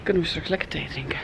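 quick hushed whisper. Real, not acted. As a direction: whisper it quickly under your breath.

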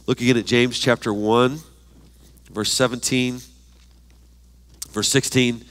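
ordinary talk

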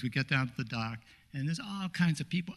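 ordinary talk, nothing marked

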